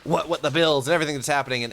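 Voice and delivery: normal talking voice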